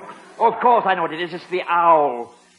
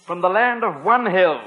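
ordinary talk, nothing marked

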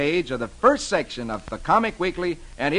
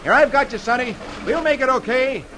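urgent reassurance; 8 semitones higher than usual. Acted. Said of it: "Roy grabs the boy and says".

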